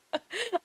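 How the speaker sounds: high short laugh; quick self-mocking laugh. Real, not acted. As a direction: laugh at yourself. Laughs at herself.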